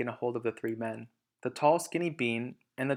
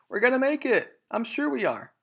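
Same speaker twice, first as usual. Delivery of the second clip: high, louder put-on voice; hopeful cry; 12 semitones higher, 5 dB louder than usual. Mister Fox encouraging his family, high and hopeful. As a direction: cheer them on hopefully. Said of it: "Mister Fox would say".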